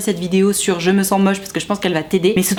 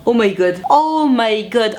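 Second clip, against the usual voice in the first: dismayed oh my god; much slower than usual. Real, not acted. Reacting to the story's ending.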